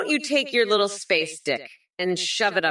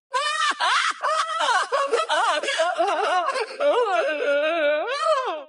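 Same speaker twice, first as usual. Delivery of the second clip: cackling laugh; 12 semitones higher than usual. Acted. Mock Kamala cackling between insults.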